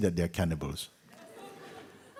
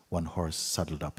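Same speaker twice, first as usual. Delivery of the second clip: ordinary delivery, nothing marked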